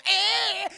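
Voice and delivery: high, loud mock shriek; startled scream. Madea as the clown turns his head, loud shriek.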